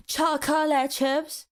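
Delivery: "chocolate chips" slow, in separate syllables; drawn-out syllables. Shown as a demonstration. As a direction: stretch "chocolate chips" with mock care. Mock drawn-out correction.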